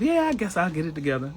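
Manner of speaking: high whiny mock voice; mock self-pity. Mocking self-doubters in a high whiny voice. Mocking people talking negatively.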